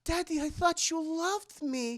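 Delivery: put-on high little-girl plea; imitated hurt girl. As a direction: plead high, playing a wounded daughter.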